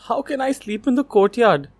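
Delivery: high, loud aggrieved protest. A narrator's reading. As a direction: whine it out, wronged and put upon. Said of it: "he said, aggrieved".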